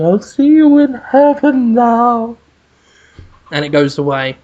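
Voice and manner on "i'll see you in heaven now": high, slow, louder ghostly call from afar. Acted. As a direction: float it down like a ghost from the sky. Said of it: "hear Sandra Bullock just saying".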